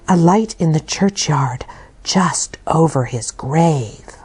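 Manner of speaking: hushed ghostly whisper. Acted. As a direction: whisper it like a scary rumour. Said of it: "They were whispering together".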